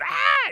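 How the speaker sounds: very high, loud mock shriek; roller coaster scream. Pastor acting out the screaming mother from a meme, loud high mock scream. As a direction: shriek like a terrified rider. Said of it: "the one going".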